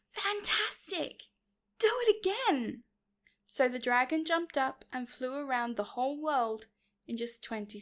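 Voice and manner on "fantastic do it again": high, excited shout. A narrator's reading. Elizabeth egging the dragon on to fly, shouted. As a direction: cheer on loudly for an encore. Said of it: "Elizabeth shouted".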